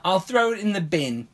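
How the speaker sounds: badly faked British accent. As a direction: join in with a joke British accent. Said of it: "say it with a British accent".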